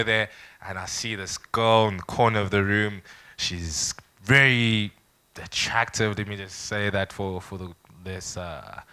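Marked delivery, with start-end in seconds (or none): 3.4-6.2 very slow, stretched-out delivery; dramatic effect. Shown as a demonstration. Storyteller describing a girl at the party, slow for dramatic effect. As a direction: draw out "very" and pause for drama. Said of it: "dramatic effect".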